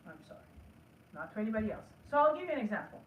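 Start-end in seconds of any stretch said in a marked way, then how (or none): 0.1-0.4 "I'm sorry" nearly whispered, much softer and lower; soft apology. Shown as a demonstration. Saying sorry quietly to herself, not to anybody else. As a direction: murmur the apology inward. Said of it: "say to ourselves".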